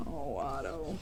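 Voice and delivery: soft, low, fond sigh of a name; tender. Acted. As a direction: say his name gently.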